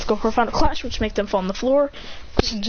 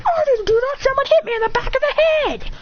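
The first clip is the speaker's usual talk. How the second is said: very high frantic yell; defensive protest. Acted. Boy denying blame to his angry rival, high frantic protest. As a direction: protest at full mock panic, pitched way up.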